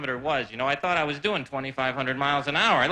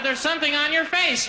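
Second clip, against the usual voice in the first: blurted warning; 11 semitones higher, 4 dB louder than usual. Retold or imitated. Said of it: "I said".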